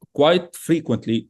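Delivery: ordinary talk, nothing marked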